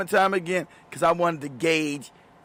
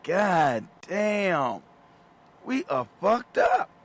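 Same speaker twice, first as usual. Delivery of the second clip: mock despair; much slower than usual. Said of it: "I was like".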